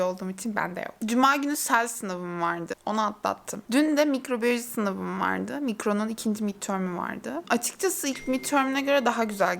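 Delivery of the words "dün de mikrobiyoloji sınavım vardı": usual speaking voice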